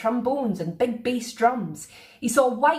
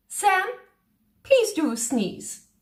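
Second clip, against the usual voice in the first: regal request; 8 semitones higher, much slower than usual. A narrator's reading. Queen asks.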